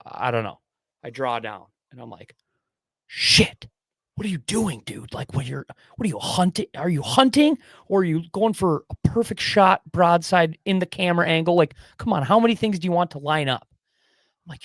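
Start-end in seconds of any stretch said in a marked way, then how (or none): 3.1-3.7 a sharp breathy whispered curse. Retold or imitated. Hunter in his tree stand cursing under his breath, whispered. 4.1-13.7 higher, louder exasperated scolding; self-scolding rant. Retold or imitated. Hunter scolding himself for waiting too long, exasperated and loud.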